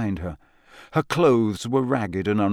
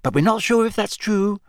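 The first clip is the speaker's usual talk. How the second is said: put-on higher, hurried girl's voice; quick reassurance; 11 semitones higher than usual. A narrator's reading. Hermione hurriedly reassures the drunk elf. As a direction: rush in to soften the news. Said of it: "said Hermione quickly".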